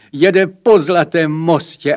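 high, loud, eager announcement; excited child. A narrator's reading. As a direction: call out the news like an excited little boy. Boy excitedly reports to his mother.

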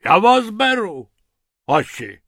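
high, loud opening, dropping at the end; delighted. Acted. Hippo jumping for joy at the rabbits, delighted. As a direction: exclaim with hearty approval.

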